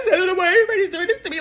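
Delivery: fast, high whiny mock voice with a laugh; mock whiny victim. Mocking her playing the victim, fast and whiny. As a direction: rattle it off like a self-pitying victim.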